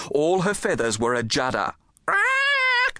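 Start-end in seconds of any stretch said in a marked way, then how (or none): 2.1-3.0 very high squawk of alarm; panicked bird screech; parrot screech. Acted. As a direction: shriek "Rack!" like a startled macaw. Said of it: "she screeched".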